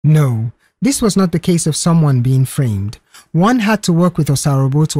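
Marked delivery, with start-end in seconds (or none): none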